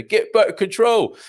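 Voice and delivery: slow, loud, high mock slogan chant; put-on slogan voice. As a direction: boom it out like a campaign slogan. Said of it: "I don't know why I did that in that voice".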